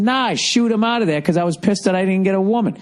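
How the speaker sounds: high put-on voice of himself; mock self. Telling friends he shooed the spa guy, in a high mock voice. Retelling his own answer.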